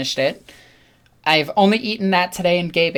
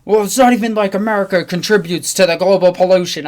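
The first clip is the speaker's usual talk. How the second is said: louder know-it-all tone; smug customer; 2 semitones higher, 4 dB louder than usual. Retold or imitated. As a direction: dismiss it like it's obvious. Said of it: "And the guy's like".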